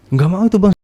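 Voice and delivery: high, loud exasperation; exasperated complaint. Real, not acted. Telling the host how the wife kept refusing, exasperated. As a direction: complain that she keeps refusing.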